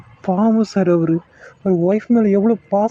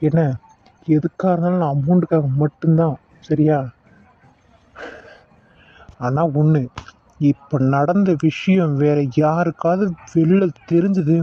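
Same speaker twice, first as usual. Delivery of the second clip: low, cold warning to a meddler; menacing villain; 7 semitones lower than usual. Acted. Villain warns the doctor to mind his own business, low and menacing. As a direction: lean in low and threaten him.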